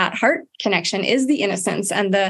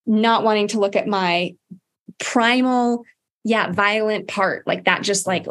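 ordinary talk

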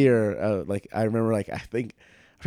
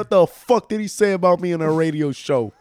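loud, high Brooklyn tirade; Brooklyn uncle; 7 semitones higher, 5 dB louder than usual. Acted. Uncle hears about the podcast, angry Brooklyn accent. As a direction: rant like an outraged Brooklyn uncle. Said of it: "if your uncle's really Brooklyn, he's gonna be like".

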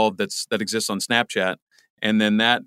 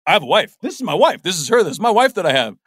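much higher, louder nervous blurting; flustered babbling fan; 8 semitones higher, 5 dB louder than usual. Retold or imitated. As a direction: introduce your wife to a star while panicking. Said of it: "I'm like".

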